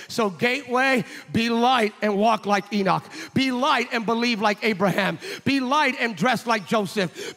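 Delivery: loud, rhythmic, rising calls; rally the church to be light; preaching exhortation. Acted. Closing exhortation.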